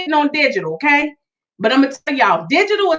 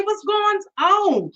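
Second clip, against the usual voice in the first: usual speaking voice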